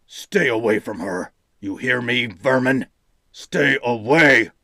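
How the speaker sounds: snarling. A narrator's reading. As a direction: spit it out as a growing threat. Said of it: "The general snarled".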